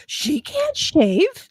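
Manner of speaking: high, slow disbelief; scandalized, drawn-out question; incredulous dad. Retold or imitated. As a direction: stretch it out in mock shock. Dad's shocked reaction retold.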